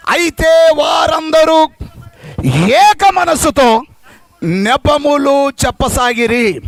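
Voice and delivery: loud, slow scripture reading; preaching scripture. Acted. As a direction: proclaim each phrase slowly. Reads scripture at full force.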